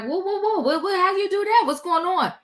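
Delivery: high, stunned whoa; shocked surprise. Retold or imitated. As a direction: react like you can't believe it. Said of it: "everybody was like".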